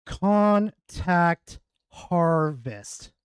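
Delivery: slow dramatic guess; drawn-out guess. Real, not acted. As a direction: stretch each word out as you commit. We do it live" guess.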